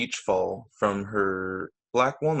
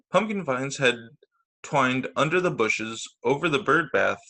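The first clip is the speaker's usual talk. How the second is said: ordinary delivery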